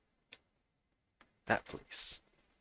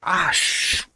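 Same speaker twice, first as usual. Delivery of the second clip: sudden loud cry of surprise cut short; a real startled shout, swallowed mid-curse; startled yelp; 7 semitones higher, 19 dB louder than usual. Real, not acted. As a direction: jump at something on screen and blurt it out. Startled mid-game outburst.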